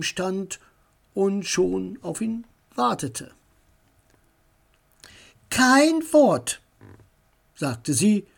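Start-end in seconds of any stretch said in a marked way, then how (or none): 5.5-6.6 stern. A narrator's reading. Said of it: "sah ihn ernst an".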